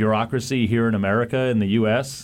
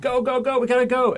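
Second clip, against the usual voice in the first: much higher, rushed go go go; urgent hurry-up; 12 semitones higher than usual. Retold or imitated. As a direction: hurry everyone out the door. Rushed retelling of urgent command.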